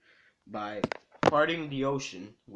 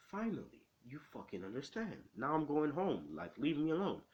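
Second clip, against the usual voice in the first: higher-pitched fed-up relief; retold exasperation; 4 semitones higher, 4 dB quieter than usual. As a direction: act out being done with someone, voice lifting. Retold exasperation at finally being understood.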